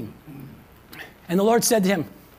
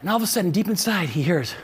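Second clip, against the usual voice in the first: ordinary delivery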